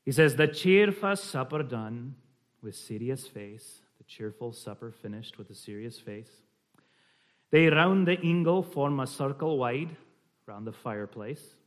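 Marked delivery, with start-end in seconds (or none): 0.4-3.8 slow, measured Scottish reading; slight Scottish accent. Shown as a demonstration. Reading a Scots poem to the group, slight Scottish accent. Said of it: "a slight Scottish accent". 7.5-10.0 louder Scottish verse reading; slight Scottish accent. Shown as a demonstration. Reading the fireside verse to the group, slight Scottish accent. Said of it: "a slight Scottish accent".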